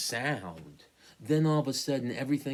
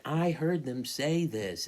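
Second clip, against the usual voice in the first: pleading insistence; 6 semitones higher, 3 dB louder, slower than usual. Pretend. Imagined frightened insistence.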